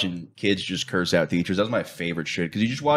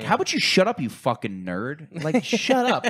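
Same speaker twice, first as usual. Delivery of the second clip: higher, half-shouted mock rant at a customer; mock angry retort; 5 semitones higher than usual. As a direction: snap it out like you're fed up. Snapping at a solar customer.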